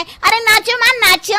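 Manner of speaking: high, eager call to dance; excited urging. Acted. Bride urging her mother-in-law to dance, excitedly.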